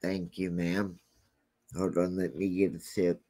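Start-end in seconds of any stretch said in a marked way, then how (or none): none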